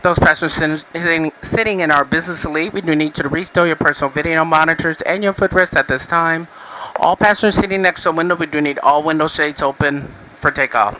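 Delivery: loud cabin intercom voice; PA announcement. Real, not acted. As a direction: announce it over the plane's speakers. Flight attendant PA announcement.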